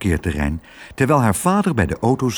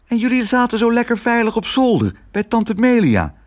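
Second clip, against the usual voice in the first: put-on high child's protest; puzzled girl's voice; 12 semitones higher than usual. Girl to mice who left the safe attic, high puzzled voice. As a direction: say it high, questioning their choice.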